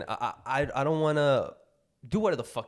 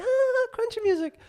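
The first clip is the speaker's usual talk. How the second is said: very high, slow dreamy mock voice; happy chill guy; 8 dB louder than usual. Teasing his always-happy friend in a very high dreamy voice. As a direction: float it up like a blissed-out hippie. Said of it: "You're always happy, like".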